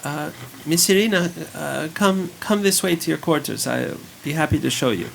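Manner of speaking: put-on high, fast child's voice. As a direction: hurry the guest along eagerly. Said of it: "Yeska says".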